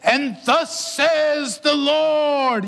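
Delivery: high, very slow, booming preacher intoning; grand mock-solemn proclamation; dramatic scripture voice. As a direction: draw it out like an audio Bible narrator. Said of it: "he's saying it like this".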